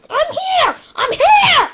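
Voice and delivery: very high, loud squeak; squeaky voice. Acted. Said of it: "Your squeaky voice".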